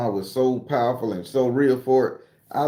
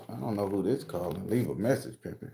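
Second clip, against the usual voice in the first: muttered aside; 5 semitones lower, 10 dB quieter than usual. Real, not acted. Mutters at incoming phone call.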